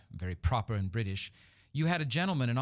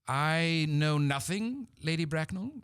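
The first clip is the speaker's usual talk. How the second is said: slow, timid, raised voice; hesitant young suitor confessing; lightly voiced nervous gentleman; hesitant Jack; 6 semitones higher, slower than usual. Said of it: "Jack answers ... with some hesitation".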